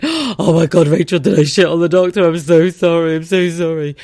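loud, frantic apology; mortified panic. Retold or imitated. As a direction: beg forgiveness in horror.

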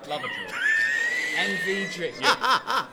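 high yelled cheer, then a laugh; shouting. Real, not acted. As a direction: belt it out with a laugh. Teasing a colleague's draw pick.

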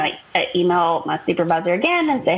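ordinary talk

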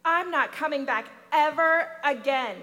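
put-on loud, upset girl declaring she quits; child stomping home, shouting to Mama in protest. Girl storms home from school.

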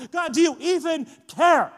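loud, hurt question to God; anguished plea. Retold or imitated. A sufferer crying out to God, anguished plea. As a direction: cry out feeling abandoned.

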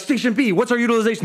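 high, projected station call; mock captain demanding a report; ship's captain. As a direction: call out to the crew. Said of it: "felt like we were on a ship".

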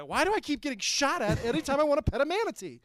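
high, fast comic complaint; hammed-up, high-pitched grievance; mock exasperated local. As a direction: whine it quickly in a put-on voice. Voicing an exasperated local in bit.

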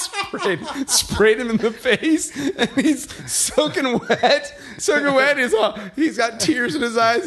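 high, laughing through the telling; barely getting words out for laughter. Real, not acted. Dad telling friends about son's fall, laughing hard.

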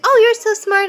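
put-on high, loud, sugary child voice; little girl voice.

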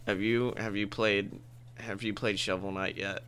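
put-on low mopey kid voice; imitating Bud Bud. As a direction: drone it like a deadpan teen. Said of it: "He's just like".

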